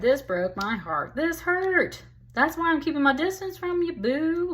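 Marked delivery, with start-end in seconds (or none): 1.2-4.5 much higher-pitched, acted hurt; hurt protest. Voicing the heartbroken woman to her partner, high hurt protest. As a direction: rush out a high, wounded complaint. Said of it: "Like, this made me sad".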